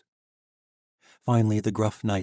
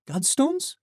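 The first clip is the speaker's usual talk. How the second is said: put-on young woman voice; 3 dB louder than usual. Sheva finishes Alec's question.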